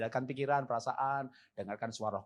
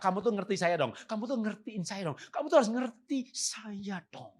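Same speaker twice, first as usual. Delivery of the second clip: mock nagging wife; 6 semitones higher than usual. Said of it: "Dan istri berkata".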